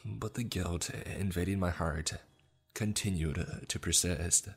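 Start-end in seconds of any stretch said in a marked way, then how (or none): none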